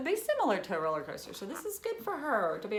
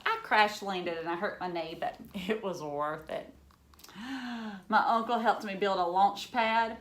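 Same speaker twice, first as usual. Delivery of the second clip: lively storybook reading for a class; reading to children. Acted. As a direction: read it like a picture book to kids. Teacher reads picture book aloud.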